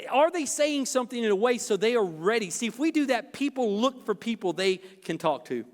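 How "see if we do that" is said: usual speaking voice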